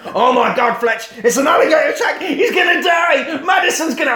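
high, loud panicked yell; hammed-up cry of alarm; mock panic. Acted panic at alligator attack.

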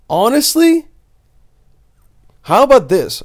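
worked up. Real, not acted. Worked up about gross classmate.

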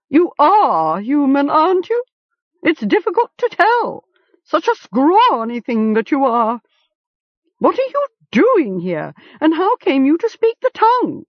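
put-on high, screechy raven, sizing up the boy; a nosy bird peering at a scrawny human; prying raven. A narrator's reading. Raven questioning Gom with curiosity.

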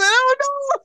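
very high-pitched imitated kid; background kid's voice. Comic retelling of a kid overheard on the phone, high child voice. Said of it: "another kid in the background say".

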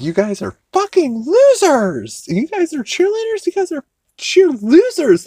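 high, mocking mean-girl taunt; bitchy head cheerleader. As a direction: sneer it loudly as a bitchy cheer captain. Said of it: "Cindy's being a bitch".

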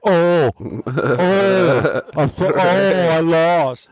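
mock a sore loser, slow and high. Friend imitates Kool-Aid losing his game, hammed-up moaning. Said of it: "He's like".

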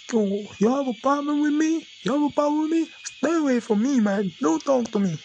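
high, pushed mock argument; high-pitched parody of a coworker arguing; mock angry coworker. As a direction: act out the coworker picking a fight.

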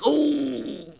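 high, drawn-out "oooh"; wincing "ooh". Acted. Listener hears a friend's bad grammar, with a high wincing oh. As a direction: hold a high, pained oh.

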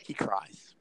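hushed, breathy reveal of the punchline; dramatic whisper. Real, not acted. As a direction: drop to a whisper for the big reveal.

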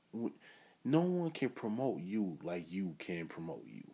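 ordinary talk, nothing marked

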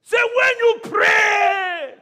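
very slow, high shout; slow, shouted, stretched words; preaching shout. Acted. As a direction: hurl each word at the room.

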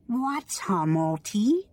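put-on high, slow little girl voice; squeaky child voice. A narrator's reading. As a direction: squeak it out like a small alien girl. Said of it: "she asked in a squeaky voice".

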